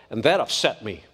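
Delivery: low, grave tone of displeasure; quietly upset. Retold or imitated. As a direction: drop the pitch, sound gravely upset. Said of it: "He said it to me in that tone of voice".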